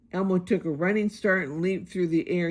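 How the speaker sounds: ordinary delivery, nothing marked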